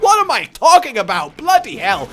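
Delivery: high, loud self-reproach; exasperated outburst. Real, not acted. Streamer catching himself rambling, exasperated. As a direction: burst out in disbelief at yourself. Exclaims at his own rambling.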